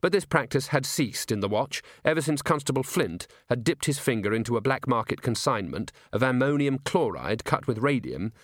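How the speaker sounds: usual speaking voice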